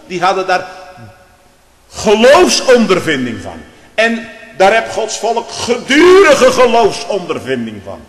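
loud, high pulpit voice; fiery preaching. Acted. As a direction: thunder it from the pulpit. Preaching at full force. (vurig preken)

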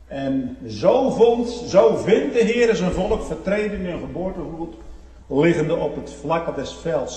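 loud, high, slow proclamation; full-force preaching. Acted.

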